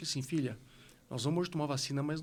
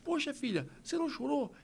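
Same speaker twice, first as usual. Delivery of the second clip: higher, delighted praise; proud celebrating dad; 9 semitones higher than usual. Retold or imitated. Father celebrating his daughter's bravery, higher delighted praise. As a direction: cheer her on, beaming. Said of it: "E eu fazendo festa".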